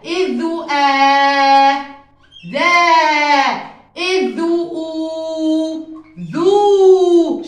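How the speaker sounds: إذ آ and إذ أو stretched very slowly, then ذا and ذو snapped out; slow sound blending. Shown as a demonstration. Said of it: "شو بنلفظها؟".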